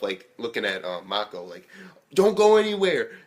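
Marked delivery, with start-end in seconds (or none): 2.1-3.2 high, loud retold command; acted warning. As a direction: call it out as a sharp warning. Acted warning from character to Mako.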